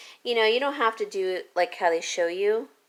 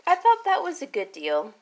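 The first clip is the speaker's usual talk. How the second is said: hiccupy, jumpy voice; fighting off hiccups; 8 semitones higher, 4 dB louder than usual. Real, not acted. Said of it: "See, I've got major hiccups".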